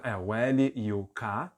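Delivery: ordinary talk, nothing marked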